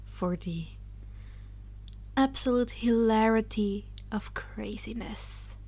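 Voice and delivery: hushed, breathy intro whisper; conspiratorial whispered opening. Acted.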